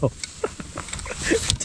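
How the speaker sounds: high, soft giggling; helpless, airy laughter; giddy laugh. Real, not acted. Angler overwhelmed by another giant, laughing giddily.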